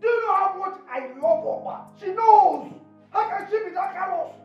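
high-pitched, hammed-up horror; over-the-top wailing indignation; outraged disbelief. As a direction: cry out in scandalized disbelief. Horrified at Opa being thrown away.

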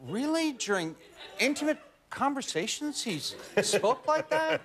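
high, incredulous questioning; questioning the coach. Retold or imitated. Actor doubting his dialect coach's lesson, high-pitched disbelief. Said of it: "And I was like".

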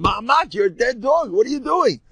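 high-pitched yell without much extra volume; storyteller's mock yell; retold yell. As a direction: imitate someone calling an alarmed warning. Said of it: "The guy yells at him".

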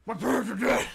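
loud, high anguished mock cry; Batman voice. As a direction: wail it as a tortured hero. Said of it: "How about Batman?".

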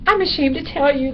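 high bat voice, reluctant confession; sheepish high-pitched bat; ashamed little bat. A narrator's reading. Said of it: "dropping his head".

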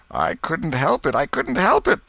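high wail, climbing on the repeat; moaning. A narrator's reading. Potter answering the crowd's question, helpless moaning. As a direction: plead helplessly, pitch rising sharply. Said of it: "Potter moaned".

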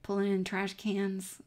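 ordinary talk

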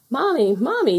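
put-on high, excited child's call; yelling. A narrator's reading. Little foxes to their mother at the den, yelling. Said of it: "yelled the little foxes together".

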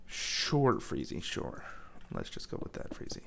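very low, soft, dismissive; humouring aside. Pretend. Gamer placating his other self, low quiet aside. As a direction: drop right down and humour him.

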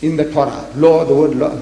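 ordinary delivery

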